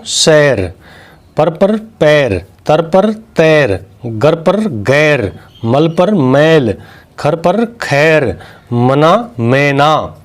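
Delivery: slow, careful word pairs; slow dictation. Shown as a demonstration. Teacher slowly reading word pairs to children. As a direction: spell out each pair slowly for the class. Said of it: "आई की मात्रा लगाएंगे तो".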